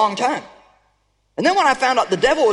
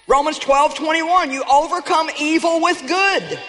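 rally the congregation; preaching at full force; 5 semitones higher than usual. Acted. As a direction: proclaim it like a victory shout. Preaching climax.

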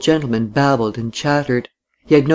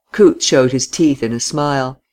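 ordinary delivery, nothing marked

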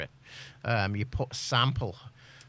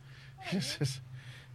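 a quick amused laugh; short laugh; 8 dB quieter than usual. Real, not acted. Chatting with friends about writing books, a short amused laugh. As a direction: laugh lightly at your own joke.

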